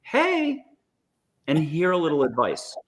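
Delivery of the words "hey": put-on much higher, bubbly greeting as a young woman; high peppy young voice. Shown as a demonstration. Guide demos the angel's voice to viewers, high and peppy. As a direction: chirp it out like a peppy twenty-eight-year-old angel. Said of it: "High, peppy, twenty-eight-year-old voice like this".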